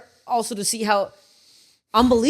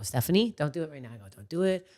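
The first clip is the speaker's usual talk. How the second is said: low, hushed self-talk; quiet, restrained warning to yourself; calming herself down; 4 semitones lower, 7 dB quieter than usual. Retold or imitated. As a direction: talk yourself down under your breath. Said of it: "I have to calm down".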